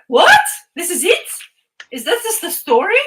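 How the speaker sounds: high, loud outraged "what?"; the friend can't believe the story ends there; stunned disbelief. Retold or imitated. Friend hearing the story just end, loud shocked disbelief.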